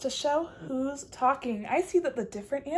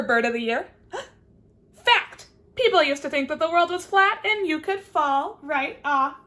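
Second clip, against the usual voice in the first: chatty bird; 5 dB louder than usual. Acted. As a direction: babble on about facts. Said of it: "she says".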